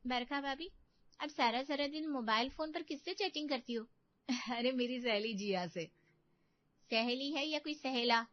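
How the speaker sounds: slyly insinuate and pretend concern; sly taunting sister-in-law. Sister-in-law taunts Barkha about her chatting, sly.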